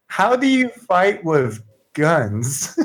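high, slow, wide-eyed question; wide-eyed Shauna. Acted. Shauna asking how guns are used, wide-eyed. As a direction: draw it out in high-pitched wonder. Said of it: "She's kinda a little wide-eyed".

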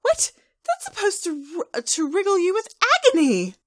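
high, loud theatrical outrage; indignant outburst. Acted. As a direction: squawk it like a thwarted villain. Trixie outraged that her zap tickles.

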